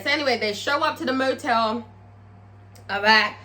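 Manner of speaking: usual speaking voice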